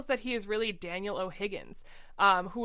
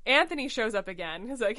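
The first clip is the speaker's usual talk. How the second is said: usual speaking voice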